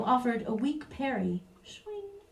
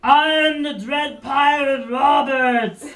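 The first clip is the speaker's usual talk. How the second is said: slow booming shout; a hammy slow bellow; 4 semitones higher, 6 dB louder, slower than usual. Acted. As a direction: bellow it out to scare the guards. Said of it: "the creature bellowed".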